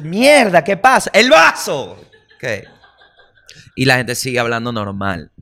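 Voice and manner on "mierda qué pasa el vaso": loud, high alarmed yelp; startled friend shouting about the glass; startled panic. Retold or imitated. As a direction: jump out of your skin. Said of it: "Y tú".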